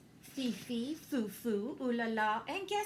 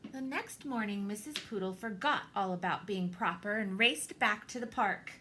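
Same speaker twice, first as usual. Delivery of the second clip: ordinary delivery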